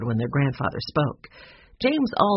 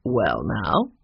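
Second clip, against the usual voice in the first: put-on lower, playful older man; lightly voiced grandfather; 6 semitones lower than usual. A narrator's reading. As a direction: drop the voice like a twinkling grandpa. Grandfather's voice, eyes twinkling.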